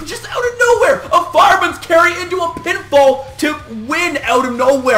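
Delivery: shouting with excitement; excited shout. Real, not acted. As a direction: yell it like a fan popping. Hyped about surprise pinfall.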